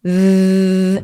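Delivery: long vibrating "Z"; voiced th sound. Shown as a demonstration. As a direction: let the "Z" buzz so the throat tingles. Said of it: "Ponte la mano aquí. Vibra".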